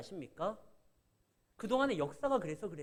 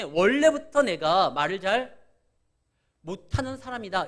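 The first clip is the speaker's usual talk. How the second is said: Moses making excuses; 9 dB louder than usual. Retold or imitated. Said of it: "그렇기 때문에".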